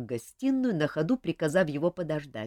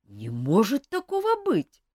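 higher, louder put-on disbelief; mock astonishment; 7 semitones higher, 7 dB louder than usual. King feigning shock at the accusation, mock astonishment. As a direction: fake shock for show. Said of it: "притворно удивился".

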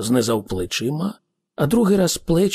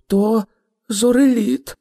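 high, raised voice, pause before "зореліт"; amazed realisation; 8 semitones higher, 4 dB louder than usual. A narrator's reading. Arthur reveals he sees a spaceship, amazed. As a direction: blurt out the discovery, pitched high.

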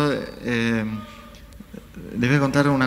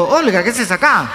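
high, loud startled question; mock astonishment; 9 semitones higher, 5 dB louder than usual. Man suddenly recognizing Olga in the cinema, loud astonishment. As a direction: imitate someone caught off guard.

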